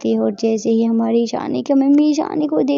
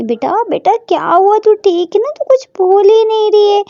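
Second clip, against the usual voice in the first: rising, louder alarmed calling; mother getting scared her daughter won't answer; anxious mother; 8 semitones higher, 6 dB louder than usual. Acted. Mother calling her silent daughter, rising into alarm.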